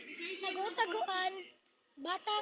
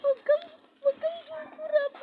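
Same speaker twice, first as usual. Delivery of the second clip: loud, high, tearful plea; scared crying; 4 semitones higher, 9 dB louder than usual. Acted. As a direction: beg through frightened tears. Scared kids in the forest.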